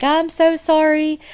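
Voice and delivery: high, slow, tearful whimper of an apology; imitating bawling. Chuck apologising to Sue, retold as a high, slow, crying plea. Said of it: "starts bawling, crying".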